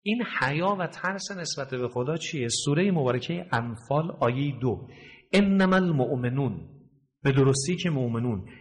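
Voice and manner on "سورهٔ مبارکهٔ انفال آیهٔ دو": ordinary talk, nothing marked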